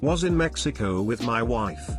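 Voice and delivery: ordinary delivery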